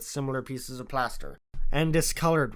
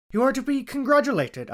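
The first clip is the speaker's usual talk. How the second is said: surprised; 11 semitones higher, 4 dB louder than usual. A narrator's reading. Surprised Watson praises Holmes.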